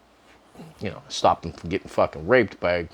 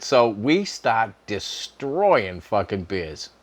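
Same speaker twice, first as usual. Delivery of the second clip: ordinary talk, nothing marked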